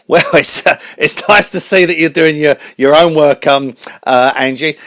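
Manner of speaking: very high, loud cartoon host voice; radio host voice. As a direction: announce the show like a squeaky showman.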